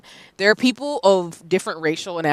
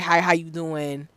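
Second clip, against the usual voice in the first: unhurried, softly spoken Southern hello; slow Southern greeting; 2 semitones lower, 8 dB quieter than usual. Acted. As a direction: take your time over the greeting, softly. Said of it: "everything is very slow".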